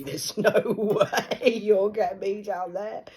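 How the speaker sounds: slow, loud, laughing defiance. Real, not acted. Mocking the idea of going down there, laughing. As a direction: laugh the words out.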